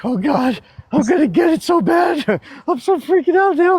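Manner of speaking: high, laughing mock terror; half-laughing retelling of sheer panic. Retelling his panic before the angry judge, high and laughing.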